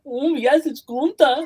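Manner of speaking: high, loud put-on announcer with a laugh; ring announcer. Joking about how the announcer should say it, hammed-up announcer voice. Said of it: "like the ring announcer".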